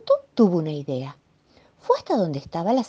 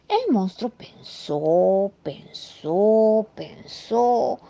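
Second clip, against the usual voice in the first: slow, each "pensó" a little higher; drawn-out storytelling; 4 semitones higher, much slower than usual. A narrator's reading. Narrator drawing out the monster's slow thinking, slow and rising. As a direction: stretch it out, building suspense.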